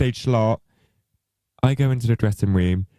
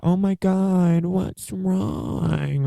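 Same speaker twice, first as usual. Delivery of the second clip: high, put-on sweet worry; fake concern; 10 semitones higher, much slower than usual. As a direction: pretend to care, sugary. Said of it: "Like, acting".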